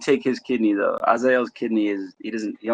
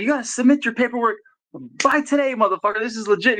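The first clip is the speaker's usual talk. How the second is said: much higher, exasperated mock yell; retold yell; 9 semitones higher than usual. Scolding his fighter over late paperwork, high mock yell. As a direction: replay scolding him, pitched way up. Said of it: "I had to yell at him".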